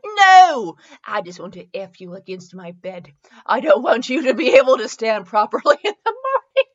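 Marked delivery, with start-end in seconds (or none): 3.5-6.7 loud, giggly read; laughing. Real, not acted. Reader struggling through a racy line, laughing. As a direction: lose composure as the line goes on.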